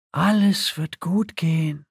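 slow, hopeful mantra; pleading self-reassurance. A narrator's reading. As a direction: invoke fate, word by word. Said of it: "beschwor Aveline Celestaris das Schicksal in Gedanken".

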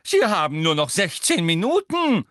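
high, loud, fussy dismay; flustered examiner tutting aloud; worried professor. A narrator's reading. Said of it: "sagte Professor Tofty besorgt".

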